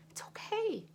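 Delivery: a high, breathy comfort, almost whispered; soothing. Retold or imitated. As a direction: hush her gently, it's okay. Comforting her daughter.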